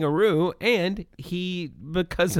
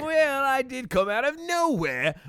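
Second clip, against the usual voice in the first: put-on shitty Australian accent. Wallaby Wanker greeting podcast viewers in a hammed-up Australian accent. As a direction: play a cheesy Aussie safety mascot. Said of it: "your shitty Australian accent".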